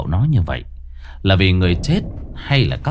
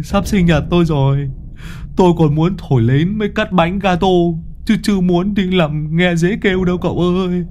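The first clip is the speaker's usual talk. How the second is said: higher, nervous, comic fright; panicky babble; 6 semitones higher than usual. Acted. Môn Phọt begging to flee, higher and panicky. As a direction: babble in a jittery high voice. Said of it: "run bành bạch thều thào".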